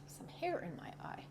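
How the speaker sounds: quick whispered aside; whispering. Real, not acted. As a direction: mutter it under your breath, unvoiced. Whispered aside mid-pose.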